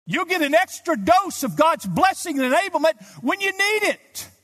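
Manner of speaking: high, loud climactic pulpit cry; fiery revival preacher at the peak; full-force preaching. Acted. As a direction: lift the congregation with a ringing promise.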